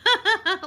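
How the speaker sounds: loud, high re-enacted burst of laughter; imitated laughing fit. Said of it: "So I started laughing".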